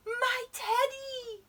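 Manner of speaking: high, slow, loud little voice; cry out. A narrator's reading. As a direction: cry out for the beloved teddy. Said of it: "cried Eddie".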